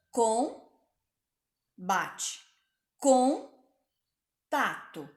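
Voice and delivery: com, bate, com, tato split with pauses between the syllables; sílabas separadas. Shown as a demonstration. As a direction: break each word into its syllables for the class. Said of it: "eu quero que vocês prestem atenção".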